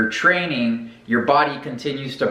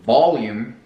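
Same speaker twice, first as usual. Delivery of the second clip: ordinary delivery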